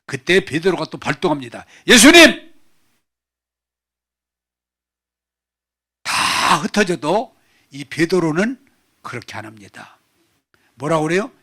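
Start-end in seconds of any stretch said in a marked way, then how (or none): 1.9-10.0 bold cry. Acted. Peter calls out boldly to Jesus, loud cry. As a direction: cry out his name, then boast.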